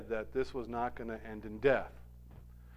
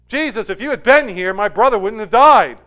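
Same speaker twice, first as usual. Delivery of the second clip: loud, higher cry at Jesus; mock accusing shout; 8 semitones higher, 17 dB louder than usual. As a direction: throw it at him as a reproach, much louder. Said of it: "Could you see Martha saying it that way?".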